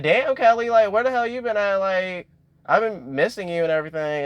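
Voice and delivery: high, excited squeal of surprise; excited shock. Retold or imitated. Imogen greets Kelly after a long absence, squealing high with excitement. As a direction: gush at a long-lost friend. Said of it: "she was just also shocked to see her".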